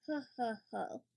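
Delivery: a dad chuckling, pleased; chuckling Daddy Pig. Acted. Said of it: "chuckles Daddy Pig".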